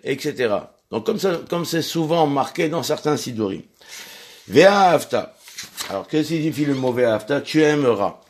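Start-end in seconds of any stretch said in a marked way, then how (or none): 4.5-5.4 loud, declaimed opening word of the verse; verse recitation. Acted. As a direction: proclaim the first word of the prayer. Announces the verse again. (déclamé fort, comme une lecture liturgique)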